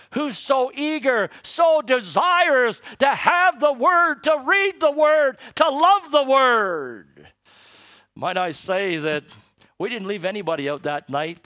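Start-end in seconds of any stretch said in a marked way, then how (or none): 0.1-7.3 swelling, louder preacher's cry; impassioned sermon peak; preaching climax. Acted. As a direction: drive it home from the pulpit, slow and strong.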